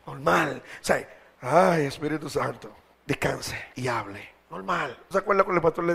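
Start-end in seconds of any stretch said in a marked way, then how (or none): 1.4-4.3 simple plain prayer. Shown as a demonstration. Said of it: "Hable con Dios normal".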